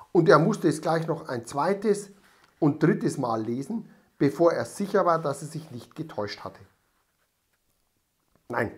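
ordinary delivery

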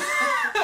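high, shrieking laugh; loud burst of laughter. Real, not acted. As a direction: explode laughing at your own line. Bursts out laughing at own retort. (yüksek sesli kahkaha)

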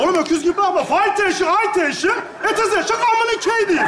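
high, strained, insistent mock English; exasperated insistence. Scolding a staring Bahadır, exasperated and high. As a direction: push the phrase out high at someone not getting it.

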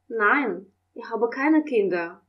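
usual speaking voice

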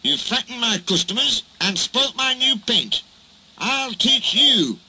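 high, indignant scolding; scold, ending in a slow threat; cross. A narrator's reading. Barber scolding Duck over spoilt paint, crossly. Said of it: "said the barber crossly".

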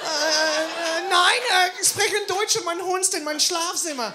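loud, high, flustered German through laughter; panicked fake German. Cornered comedian shouts panicked German at the fundraiser. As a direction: scramble desperately into bad German.

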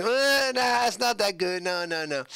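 high-pitched mock disapproval; critics' reaction. Guest imitating people judging his impression, high mock dismissal. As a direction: start high and slide down through the noes. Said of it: "So people say".

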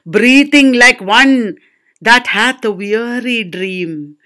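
slow, lengthened words; weary recitation. Acted. Teacher compares languid air to breathing, slow weary reading. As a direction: draw the verse out like slow breathing. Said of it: "your breathing is slow".

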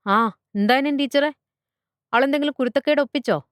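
high-pitched, hurried questioning; brisk father on call. Acted. Father asks teacher whether daughter misbehaved, brisk and high. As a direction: fire it off as a busy dad.

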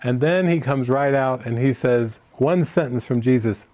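ordinary delivery

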